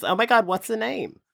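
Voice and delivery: mock New York woman asking a name; New Yorker default. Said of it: "My default in the U S is".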